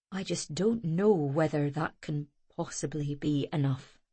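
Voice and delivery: embrace her with your voice; tender father. A narrator's reading. Father consoling his frightened daughter tenderly.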